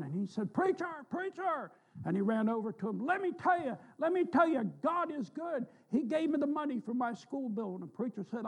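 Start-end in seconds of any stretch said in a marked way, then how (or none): 0.5-1.7 high, thrilled calling out; excited hollering. Retold or imitated. Young student runs up hollering with joy to preacher. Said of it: "hooping and hollering". 3.0-7.7 high, breathless joy; excited hollering. Retold or imitated. Student tells preacher God paid his school bill, overjoyed. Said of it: "hooping and hollering".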